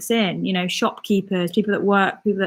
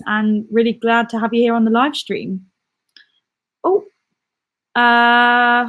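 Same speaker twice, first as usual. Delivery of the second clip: ordinary talk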